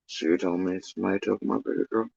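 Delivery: very soft, low, quick monotone; hushed imitation of a monotone man. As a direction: murmur it low with no inflection. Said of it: "dude with the most monotone voice".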